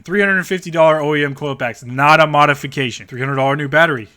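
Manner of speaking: scoffing at the seller's fake mods. Real, not acted. Ranting at listing mods.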